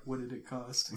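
deep, hushed Thanos line; low voice. Retold or imitated. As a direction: say it low and quiet. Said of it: "in the low voice".

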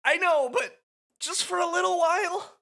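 frightened high-pitched pleading; whiny scared Rikku. Acted.

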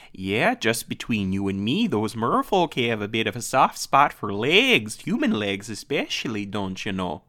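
mock stage whisper in a Minnesota accent; a would-be whisper, Minnesotan. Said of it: "in an attempt to whisper".

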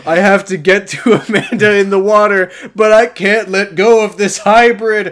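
loud, high, strained cartoon urgency; mock desperate shout. Podcaster playing Kyle stuck to a hybrid, mock desperate. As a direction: cry out as if stuck mid-crisis. Acting out Kyle in improvised bit.

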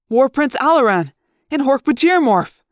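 high, loud stunned exclamation. A narrator's reading. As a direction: blurt it out in shock. Stunned recognition mid-fight.

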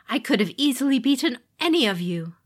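loud, raging demon voice; furious boast. Acted. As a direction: spit it out in fury. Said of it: "Fury flashed like lightning in her eyes".

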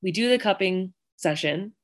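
ordinary delivery

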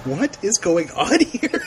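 half-laughing, slow confused question; mock bafflement, laughing a little; bewildered laugh. Host retelling her confusion while watching, laughing. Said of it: "And I'm like".

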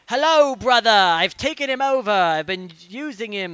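high, booming, hammed-up villain shout; Liquid. Villain greets his brother after taking over a body, loud theatrical gloating. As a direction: gloat it out theatrically at full volume. Voices Liquid possessing Ocelot.

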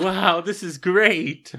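high, loud, drawn-out awe; amazed. Retold or imitated. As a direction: join in replaying an impressed reaction. Joins in reenacting amazed viewers.

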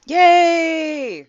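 high squeal of joy; excited cheer. Real, not acted. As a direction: cheer it out, thrilled. Player cheers at arrival.

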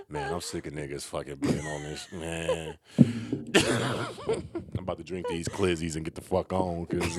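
low, slow mock slangy voice. Predicting how the co-host will talk, low mock drawl. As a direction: drag it out low, as a teasing impression. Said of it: "you gonna be like".

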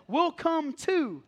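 slow, drawn-out, singsong reply; eager friends. Retold or imitated. Disciples answering Peter, slow drawn-out eager reply. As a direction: stretch it out like an eager tagalong.

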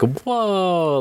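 retold wow of amazement; amazed. Podcaster recalls reacting to the dinner invitation, amazed.

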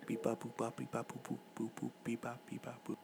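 quiet beeping robot chatter; soft toy robot voice. Pretend. Robot noises introduce research segment.

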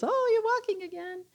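a high, playful imitation of a friendly neighbor; cheery neighbor. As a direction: greet them like a delighted neighbor. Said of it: "he always goes".

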